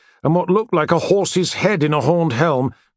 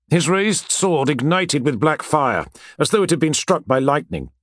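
ordinary delivery, nothing marked

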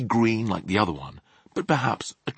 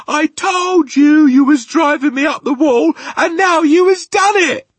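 high, very loud retort; crowing, full-voiced yell; triumphant shout; 13 dB louder than usual. A narrator's reading. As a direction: shout it back gleefully at a big sister. Retort to yelling sister, shouted.

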